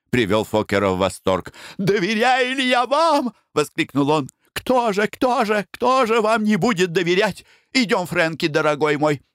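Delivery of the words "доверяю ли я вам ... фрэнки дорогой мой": Foker, tearful. Acted. Фокер восторженно отвечает Бланш.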